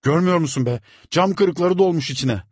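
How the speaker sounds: loud, high tearful shout. A narrator's reading. Boy shouting back at the servant. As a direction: cry out in pain. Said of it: "Çocuk bağırıyordu".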